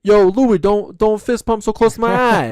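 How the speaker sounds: high, loud mock Jersey Shore guy; Jersey Shore accent. Imitating a Jersey Shore cast member scolding his buddy, in a loud Jersey accent. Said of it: "let me see if I remember any Jersey Shore".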